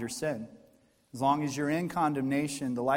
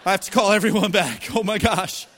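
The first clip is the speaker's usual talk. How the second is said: higher and louder, laughing through the words; 6 semitones higher, 7 dB louder than usual. Real, not acted. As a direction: laugh helplessly at the mix-up. Mom realizes her LOL mistake.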